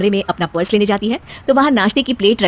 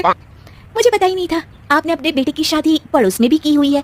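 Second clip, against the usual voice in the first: teasing daughter-in-law; 6 semitones higher than usual. Acted. Daughter-in-law teasing her mother-in-law, sly and playful.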